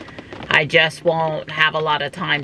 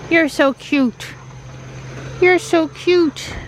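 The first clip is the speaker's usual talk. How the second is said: pet talk; 13 semitones higher, slower than usual. Real, not acted. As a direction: coo it to the cat. Cooing at cat.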